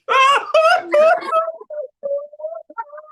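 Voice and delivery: loud high-pitched fake wail; hammed-up sobbing howl; mock wailing. Kalavali falls at Jatila's feet, mock wailing. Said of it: "starts wailing and crying profusely".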